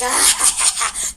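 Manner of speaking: loud, high burst of mock laughter; imitated excited cackle. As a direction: mimic a gleeful, airy "ah".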